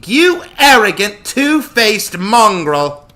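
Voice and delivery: slow, high, loud denunciation; furious goddess. A narrator's reading. Artemis appears and berates Joshua, furious outburst. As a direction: spit each insult out slowly and loudly.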